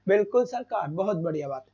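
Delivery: low, soft winding-down praise; quiet, low nod of approval; subdued approval. Real, not acted. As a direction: drop the voice after the big cheer. Cheer winds down to aside.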